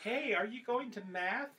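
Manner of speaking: high, slow put-on voice; Family Guy bit. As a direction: draw it out in a high cartoon voice. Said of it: "That's that Family Guy bit".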